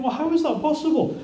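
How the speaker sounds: high, baffled question; incredulous. Retold or imitated. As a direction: disbelief at his words. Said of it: "You think".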